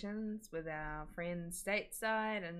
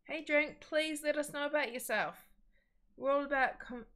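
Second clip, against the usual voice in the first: bright welcome; 10 semitones higher, 6 dB louder than usual. Real, not acted. Welcoming viewer to chat.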